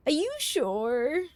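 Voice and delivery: slow, lilting tease; teasing drawn-out question. Shown as a demonstration. Streamer teasing chat, slow doubtful lilt. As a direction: stretch it out, doubting them.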